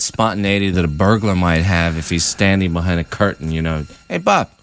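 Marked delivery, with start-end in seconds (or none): 4.1-4.5 high, sharp snap; snappy husband. Retold or imitated. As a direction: bite his head off. Unhappy husband snapping at friend.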